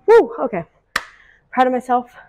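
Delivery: loud triumphant woo; excited cheer. Real, not acted. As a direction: whoop with real pride. Cheering after finishing the workout.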